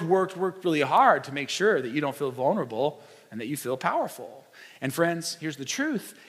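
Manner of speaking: ordinary talk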